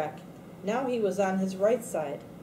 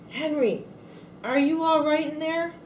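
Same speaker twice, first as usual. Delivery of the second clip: calling out; 8 semitones higher than usual. Acted. Calling into the box after knocking.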